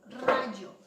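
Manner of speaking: RAYO punched loud on RA, falling off on yo; emphatic RAYO. Shown as a demonstration. Teacher asking class for the stressed syllable of rayo, emphatic. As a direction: hit the first syllable of rayo hard. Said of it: "¿Cuál es la sílaba tónica?".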